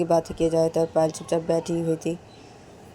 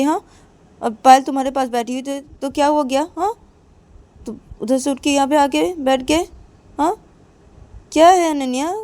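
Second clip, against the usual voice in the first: scolding mother; 6 semitones higher than usual. Acted. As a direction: demand why she is angry. Mother scolds Ananya again. (ऊँची आवाज़ में माँ की डाँट)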